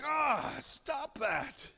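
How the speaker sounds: high drawn-out outburst; cranky startled cry; startled protest. Acted. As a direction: yell at the prankster.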